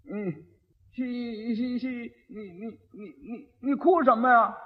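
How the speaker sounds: quiet halting stammer, stuck on each syllable; a simple-minded groom tripping over his words; a lightly voiced dim groom; stammering idiot. Idiot husband stammers at bride.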